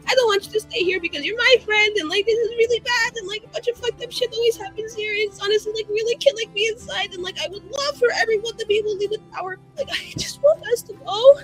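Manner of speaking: high, breathless nervous ramble; anxious pleading. Acted. Begging friend Cyril to run away together, anxious pleading.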